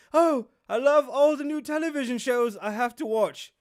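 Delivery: high, loud mock enthusiasm; mock a prince gushing under duress; hammed-up, overeager prince voice; Harry impression. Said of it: "I'll be Harry".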